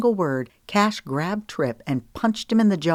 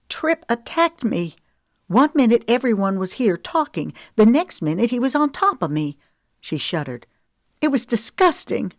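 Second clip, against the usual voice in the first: higher, louder, rushed and distressed; upset pleading; 7 semitones higher, 4 dB louder than usual. Acted. Woman begging her lover to believe her, higher and distressed.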